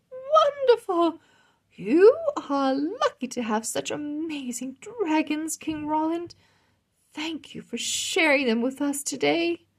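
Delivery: high, bright-eyed queenly voice; delighted queen. Acted. Queen teasing King Roland at the feast, high and delighted.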